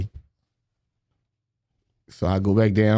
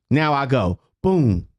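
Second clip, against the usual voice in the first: ordinary talk, nothing marked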